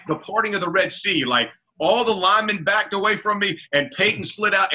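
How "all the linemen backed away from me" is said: normal talking voice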